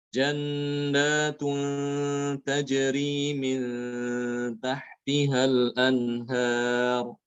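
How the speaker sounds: slow level recitation, n held in "Antum", "Jannatun", "min"; ikhfa before ta. Shown as a demonstration. Teacher demonstrates the letter ta, slow held nasal. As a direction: hum the n long before each t, as in "Antum". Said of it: "pada huruf ta ya".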